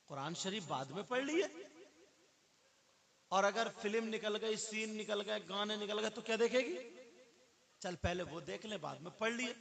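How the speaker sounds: high, loud tempting friend; a friend luring a girl to a film, high loud coaxing. Retold or imitated.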